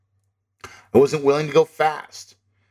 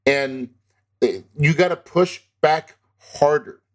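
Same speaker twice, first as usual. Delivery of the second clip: ordinary talk, nothing marked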